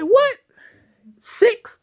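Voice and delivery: very high, stunned double-take; squeaky astonished what; shocked. Retold or imitated. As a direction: gasp out the surprise way up high. Shocked by buying six stores.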